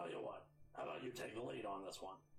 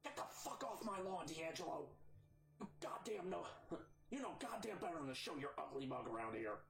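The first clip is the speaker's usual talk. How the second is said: angry whisper yelling; 6 semitones higher than usual. Acted. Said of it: "a very loud and very angry whisper".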